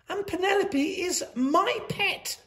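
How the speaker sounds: put-on high childlike insisting; girl's voice. A narrator's reading. Miranda telling the headmistress, small high insistence. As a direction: stand up for your pet, small but firm. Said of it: "said Miranda".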